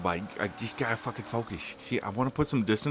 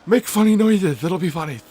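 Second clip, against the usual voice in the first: much higher, louder goofy urging; voice of his brain; 12 semitones higher, 11 dB louder than usual. Retold or imitated. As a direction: play your silly brain egging you on. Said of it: "My brain's just like".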